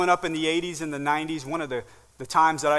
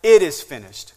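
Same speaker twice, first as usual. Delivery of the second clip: cried out; 9 dB louder than usual. Acted. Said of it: "He cried out".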